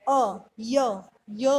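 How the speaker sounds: अ, य, य as separate drawn syllables; EA sound demo. Shown as a demonstration. Teacher showing what e-a sounds like, अ, य, य sounded out. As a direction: sound out य as the glide ending of "near". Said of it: "ई ए की sound क्या आती है".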